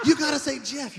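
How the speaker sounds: loud, high crowd coaching; rally the room to shout back; hype-man call. Acted.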